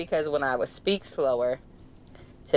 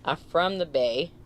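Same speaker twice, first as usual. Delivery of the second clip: usual speaking voice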